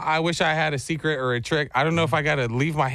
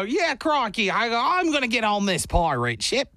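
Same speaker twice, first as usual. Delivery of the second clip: playful mock Australian, pitched much higher; Australian accent; 6 semitones higher than usual. Dad plays along with his son on the ship, mock Australian. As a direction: join in the son's Australian bit. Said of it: "That's Australian".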